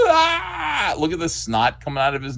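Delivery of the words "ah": high drawn-out wail; anguished scream. Acted. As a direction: cry out in horror. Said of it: "And he goes".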